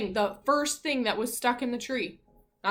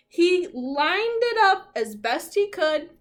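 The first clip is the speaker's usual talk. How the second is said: dramatic reading; 8 semitones higher, 8 dB louder, slower than usual. A narrator's reading.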